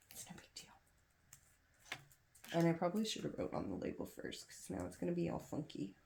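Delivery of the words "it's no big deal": slow resigned whisper. Real, not acted. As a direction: whisper it slowly to yourself. Muttering to herself after spill.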